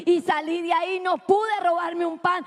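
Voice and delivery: normal talking voice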